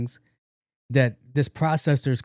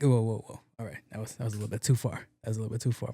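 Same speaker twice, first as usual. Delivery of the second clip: sheepish retreat; 3 semitones lower, 8 dB quieter, faster than usual. Real, not acted. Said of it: "backing off".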